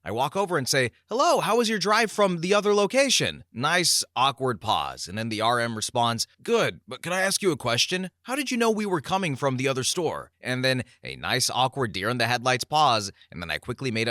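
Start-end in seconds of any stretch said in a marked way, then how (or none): 1.1-3.4 chirpy high customer-service voice; sweet, eager shop greeting; polite greeting. Retold or imitated. Narrator voicing himself, sweetly greeting the visiting manager. 6.4-10.3 higher, guarded store manager voice; a touch of wary curiosity; suspicious manager. Retold or imitated. Regional manager quizzing the employee, lightly voiced. RM's reply voiced in story.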